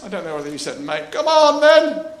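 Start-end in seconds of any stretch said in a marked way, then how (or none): 1.1-2.1 higher, louder hearty call; Jesus calling Peter out of the boat. Retold or imitated. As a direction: beckon him on. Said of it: "this voice comes back".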